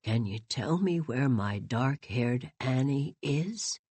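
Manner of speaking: put-on low, soft, slow pleading old voice; old man asking Teddy for his lost Annie; wistful entreaty. A narrator's reading. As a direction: plead gently, longing. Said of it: "entreated mad Mister Morrison wistfully".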